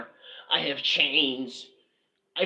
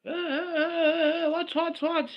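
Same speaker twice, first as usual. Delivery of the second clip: very high, slow drawn-out eh; high playful calls. Pretend. Vlogger calling out playfully before his accent talk, high and slow. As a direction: call out in a high teasing voice.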